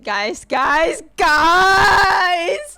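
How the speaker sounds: building from talk to a high, loud scream; frantic excited scream. Real, not acted. Streamer to viewers after the trailer, frantic scream.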